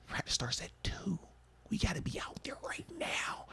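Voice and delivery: hushed, breathy warning to hurry; urgent whisper. Retold or imitated. Teammate whispering to A B that practice is starting, urgent whisper. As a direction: whisper it so the coach won't hear.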